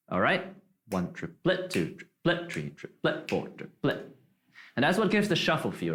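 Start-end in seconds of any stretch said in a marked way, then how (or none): 0.9-4.1 "triplet" with its last syllable punched, "trip" swallowed; softly count, popping the "let" of each "triplet"; stress on LET. Shown as a demonstration. Teacher shows shuffle emphasis, stress on end of "triplet". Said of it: "as well as the lit".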